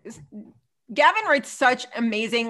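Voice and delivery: normal talking voice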